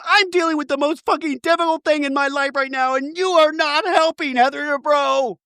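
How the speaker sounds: very high sustained wail; mock distraught outburst. Recapper mimicking housewife snapping at unhelpful friend, mock wailing. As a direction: wail it all on one strained high pitch.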